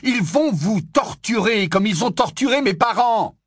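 crying out. Acted. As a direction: plead loudly, alarmed. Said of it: "s'écria Harry".

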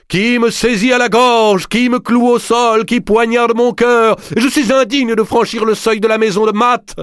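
déclamation très aiguë, lente et forte; hammy stage actor. Acted. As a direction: clame son indignité en extase. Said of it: "en déclamant". (Bramanti déclame, extatique, très aigu et lent)

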